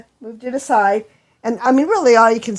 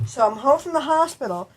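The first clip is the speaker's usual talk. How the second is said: usual speaking voice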